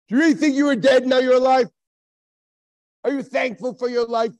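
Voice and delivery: high, loud, demanding questions; hammering a twelve-year-old with challenges; rabbi shouting at boy. Retold or imitated. The rabbi challenges a young student, shouting.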